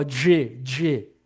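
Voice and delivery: G said with a Portuguese E vowel, marked as the error; wrong vowel example. Shown as a demonstration. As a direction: demonstrate the mistake G. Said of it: "Tá errado". (exemplo errado de G com E do português)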